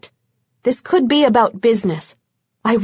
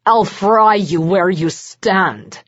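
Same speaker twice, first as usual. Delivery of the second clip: growled threat; slower than usual. A narrator's reading. Vlad threatens Bones with fire, a slow growl. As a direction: growl it slowly through the threat. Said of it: "something like a growl".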